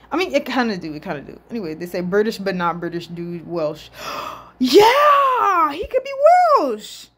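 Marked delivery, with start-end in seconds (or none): 3.9-4.6 sharp, delighted intake of breath; sudden gasp. Real, not acted. Reacts to Welsh suggestion. 4.6-7.1 very high, excited squeal; excited realization. Real, not acted. Delighted by the Welsh suggestion.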